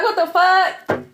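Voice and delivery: mock-outraged at being denied hell; incredulous outburst. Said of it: "Imagine me like".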